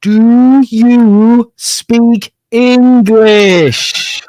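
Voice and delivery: very slow, loud, high, drawn-out words; talking to a foreigner. Retold or imitated. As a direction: stretch every word for someone who won't understand. Stranger addresses her as a foreigner.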